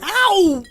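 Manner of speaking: sharp high yelp; yelp of pain. Acted. Gerald bitten by the dog, high yelp. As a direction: yelp as the teeth sink in.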